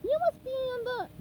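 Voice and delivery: high anxious calling. Acted. SpongeBob searching his house, high anxious call. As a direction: call out, hoping to find him.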